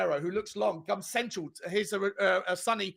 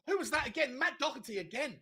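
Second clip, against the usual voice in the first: ordinary talk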